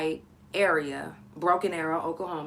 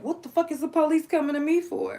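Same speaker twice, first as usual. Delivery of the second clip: much higher, incredulous outburst; retold disbelief; 10 semitones higher, 4 dB louder than usual. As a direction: caught off guard by the police. Shocked as police approach.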